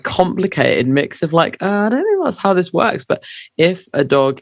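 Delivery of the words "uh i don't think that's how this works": a high, prim dog voice; lightly voiced indignant dog; mock indignant dog. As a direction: play the offended little dog. Voicing the dog.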